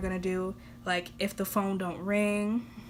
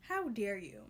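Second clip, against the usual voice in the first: normal talking voice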